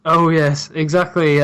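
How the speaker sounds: ordinary talk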